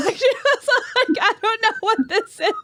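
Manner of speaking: real laughter breaking through the words; laughing. Real, not acted. Telling friends a family story, laughing. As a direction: laugh your way through the line. Laughing while retelling grandma.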